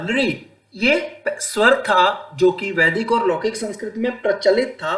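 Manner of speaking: normal talking voice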